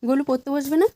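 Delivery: high, rising coaxing question; coaxing sing-song. Real, not acted. Mother coaxing her toddler to study, high and rising.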